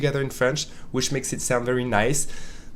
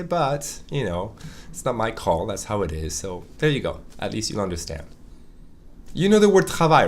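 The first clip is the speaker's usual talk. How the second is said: ordinary talk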